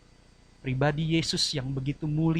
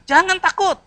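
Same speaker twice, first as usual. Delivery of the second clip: high, loud call to the congregation; 10 dB louder than usual. Shown as a demonstration. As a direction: proclaim it for all to repeat. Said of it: "Katakan kiri kanan". (seruan keras kepada jemaat)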